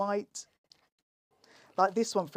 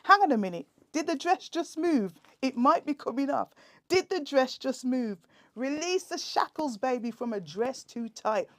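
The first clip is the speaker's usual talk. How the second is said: higher, louder, rallying chant to break free; mock-heroic battle cry in the cubicle; triumphant build; 6 semitones higher, 7 dB louder than usual. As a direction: rouse the struggle to victory. Poem climax, breaking free.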